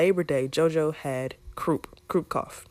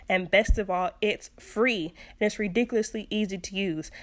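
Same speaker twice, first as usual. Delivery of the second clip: bright higher sponsor read; podcast ad read; 4 semitones higher than usual. Acted. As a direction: read it like a friendly ad spot.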